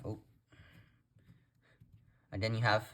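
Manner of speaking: usual speaking voice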